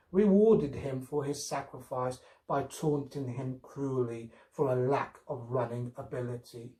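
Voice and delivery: plain reading voice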